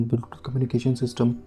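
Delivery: ordinary delivery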